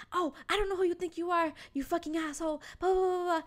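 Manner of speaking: mild mock tirade into blahs; mock yelling back. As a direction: imitate an indignant woman cursing out the driver. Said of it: "yelling back".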